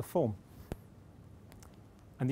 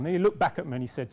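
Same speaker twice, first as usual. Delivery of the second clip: usual speaking voice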